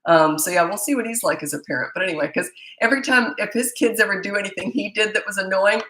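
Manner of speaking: normal talking voice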